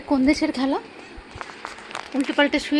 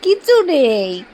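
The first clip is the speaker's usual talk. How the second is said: teasing; 6 dB louder, much slower than usual. Real, not acted. Teasing the dog, empty hand.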